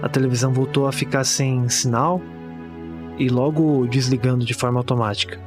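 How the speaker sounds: grave, pausada horror narration; narração de conto de terror. Acted. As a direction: narrate a bleak horror tale, measured, with long pauses. (narração sombria de conto de terror)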